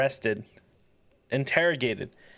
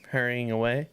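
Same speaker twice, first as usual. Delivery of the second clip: ordinary talk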